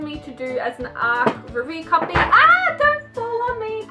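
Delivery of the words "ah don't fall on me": high, loud cry of alarm; startled yelp. Real, not acted. Vlogger as the books topple toward her, startled yelp. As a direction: yelp as something starts to topple onto you.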